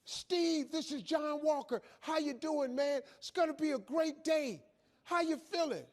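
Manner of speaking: high-pitched, chirpy morning pep call; bursting with morning cheer; relentlessly upbeat caller. Acted. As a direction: imitate an overly cheerful wake-up caller. Imitating sponsor's relentless morning call.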